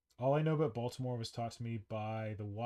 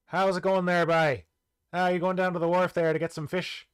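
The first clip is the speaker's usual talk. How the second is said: loud, higher Newfoundland accent, hammed up; Newfie accent; 5 semitones higher, 9 dB louder than usual. Shown as a demonstration. Doing a Newfie accent for the chat, loud and high. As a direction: ham up a Newfie fisherman's twang. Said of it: "can I do a Newfie accent?".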